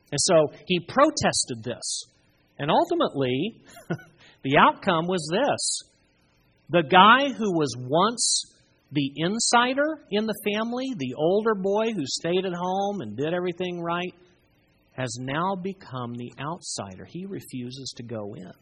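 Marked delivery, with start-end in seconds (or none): none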